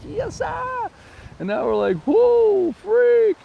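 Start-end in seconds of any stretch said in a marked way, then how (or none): 0.0-0.9 a much higher squeak of mock delight; squeaky yes sir. Locals answering good times in a high squeak. Everyone's cheerful reply. 2.1-3.4 high, loud startled yelp; mock freak-out. Islanders reacting to the crisis with a high yelp.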